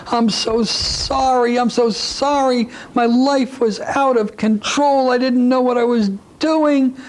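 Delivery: high, slow mock sobbing plea; a mother crying to her lost baby, imitated sobbing. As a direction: play a grieving mother apologising through mock tears, slowly. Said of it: "She'll start to cry".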